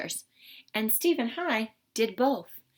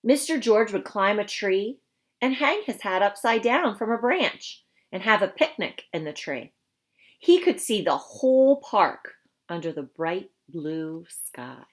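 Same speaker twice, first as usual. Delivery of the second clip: lively, expressive storybook voice; reading to children; 2 semitones higher, 7 dB louder than usual. Acted. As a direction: read it to a class of young kids. Reads picture book to children.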